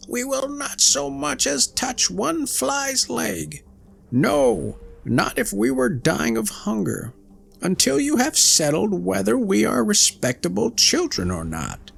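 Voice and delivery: very high, squeaky indignant chicks; indignant young birds. Acted. Young wrens refusing food from parents, indignant.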